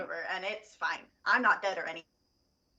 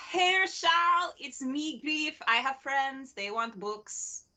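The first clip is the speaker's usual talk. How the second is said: Griff; 5 semitones higher, slower than usual. Acted. Griff greets the shopkeeper on walking in, high hail. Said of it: "I go".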